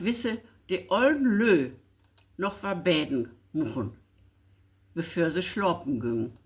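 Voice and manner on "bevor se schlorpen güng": normal talking voice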